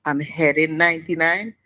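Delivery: ordinary talk, nothing marked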